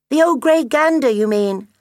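put-on high, loud childlike voice; young gosling. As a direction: call out like an eager little gosling. Gosling questions his mother.

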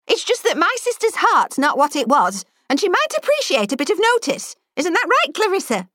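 put-on high, pointed old lady's demand; sharp old spinster voice; stern elderly lady. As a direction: tart old woman needling the girl. Elderly lady's sly remark.

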